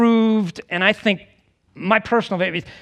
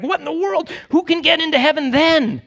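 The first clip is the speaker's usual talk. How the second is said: much higher, incredulous outburst; astonished disciples; 10 semitones higher than usual. Acted. Preacher voicing disciples shocked at Jesus' saying, astonished. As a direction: ask it in high-pitched disbelief. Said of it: "his disciples are like".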